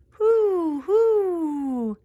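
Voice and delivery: high owl hoots, falling; long pretend owl calls. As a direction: hoot like an owl. Voicing the toy owl.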